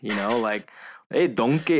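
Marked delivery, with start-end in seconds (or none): 1.1-1.8 higher, louder Scottish ogre greeting; Shrek accent. Acted. Host demonstrating his Shrek line to friends, Scottish accent. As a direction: call out like Shrek in a Scottish brogue. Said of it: "trying to do the Shrek accent".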